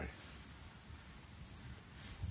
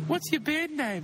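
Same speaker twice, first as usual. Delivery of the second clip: put-on high girly voice; girl voice. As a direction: play a girl chatting up a guy. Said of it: "I'm a girl".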